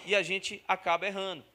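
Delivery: usual speaking voice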